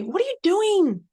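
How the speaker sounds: mock child outburst, high and loud; upset child. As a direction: snap back like an upset kid. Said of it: "I heard one of them say".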